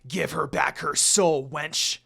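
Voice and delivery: growled. Acted. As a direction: menace him into obeying. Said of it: "Inuyasha growled".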